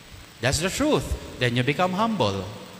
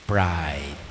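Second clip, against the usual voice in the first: low, heavy pronouncement; 12 semitones lower, 6 dB quieter than usual. Real, not acted. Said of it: "priest names the sin".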